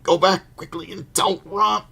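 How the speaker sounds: high, loud, strained yelling from far inside a wall; trapped voice in the chimney. A narrator's reading. Boy shouting from inside the blocked fireplace, high and loud. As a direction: shout in a panic, jammed in a tight space. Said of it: "Voices could be heard from inside".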